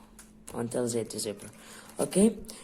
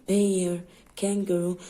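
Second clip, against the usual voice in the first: normal talking voice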